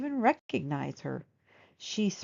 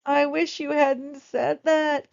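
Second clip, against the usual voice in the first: put-on slow, high, loud whiny girl voice; groaning girl; 7 semitones higher, 6 dB louder, slower than usual. Girl dismayed at the therapist's words, slow groan. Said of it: "Camilla groaned".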